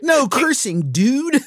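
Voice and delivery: high-pitched cartoon kid impression; Bart Simpson. Dad voices a cartoon character on a no-cursing sign, high kid voice. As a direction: pitch it up like a cheeky cartoon boy. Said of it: "like Bart Simpson".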